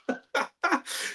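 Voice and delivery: airy, whispered real laugh; breathless laugh. Real, not acted. Streamer wheezing with laughter at the chat, breathless laugh.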